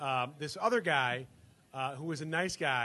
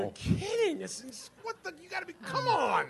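high-pitched, sputtering incredulity; mock-appalled, breaking off mid-phrase; retold disbelief; 14 semitones higher than usual. Friends hearing the bunk-peeing story, imitated high incredulous sputter.

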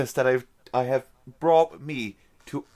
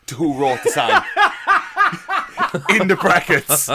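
breaking into laughter mid-story; laughing; 3 semitones higher, 3 dB louder than usual. Real, not acted. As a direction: laugh through the punchline. Cracking up at his story.

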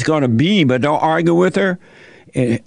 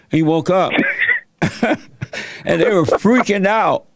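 ordinary talk

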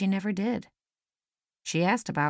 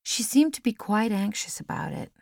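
usual speaking voice

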